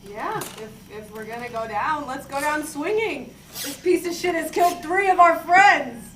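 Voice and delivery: usual speaking voice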